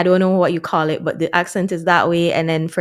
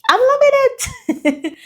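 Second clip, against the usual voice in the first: laughing; slower than usual. Real, not acted. Sing-song slogan, laughing.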